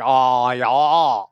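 mock broad Maine accent; drawn-out, hammed-up Maine vowels. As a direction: put on a thick Maine accent. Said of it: "Does your wife have a Nard accent?".

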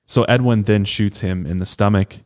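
ordinary talk, nothing marked

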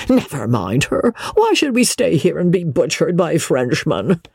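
high-pitched, impatient coward; panicky Joss. Acted. Joss urging Amelia to flee the French, agitated protest.

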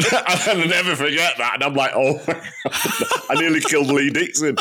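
talking through real laughter; helpless laughs breaking up the words; laughing. Real, not acted. Golfer recalling how he nearly killed his playing partner, laughing. Laughing over near-miss golf story.

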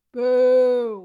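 long, loud drawn-out boo; playful booing. Pretend. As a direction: boo it like a heckler. Booing the exemption from the vote.

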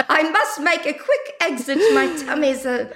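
high, hammed-up British voice; mock British, pitched high; put-on British accent. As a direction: make prim excuses in a bad British accent. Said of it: "that accent".

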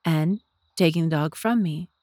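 ordinary talk

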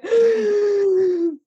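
sharp high gasp of exasperation; exasperated gasp. Pretend. Wanting Mrs Elton to draw breath, a sharp high gasp. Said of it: "you wanna go".

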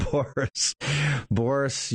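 says the name through a laugh; chuckling while calling him. Real, not acted. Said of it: "I'm not laughing 'cause it's".

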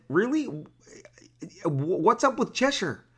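spring up high in baffled disbelief; incredulous question. Retold or imitated.

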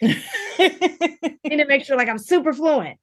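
high, slow, laughing mock of her younger self; mock earnest vow, laughing. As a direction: squeak it out like a giddy student laughing at herself.

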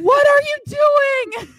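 high, loud, slow yell at mom; panicked shout. Retold or imitated. Daughter yelling at her mom in the airport, panicked shout. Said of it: "I'm like".

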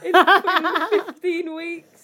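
high, loud and slow, laughing; laughing through tears. Real, not acted. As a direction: laugh out the words while welling up. Laughing through emotional moment.

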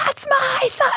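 proud parent. Retold or imitated. Parent wishing to shout proudly.

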